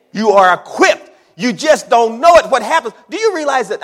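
loud, high, emphatic preaching; full-force preaching. Real, not acted. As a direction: drive it home to the congregation.

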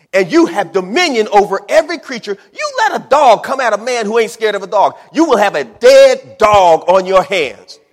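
loud, high, rising sermon fervour; full-force preaching. Real, not acted.

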